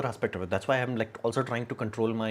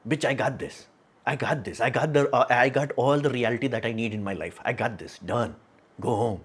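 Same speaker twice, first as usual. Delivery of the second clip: ordinary delivery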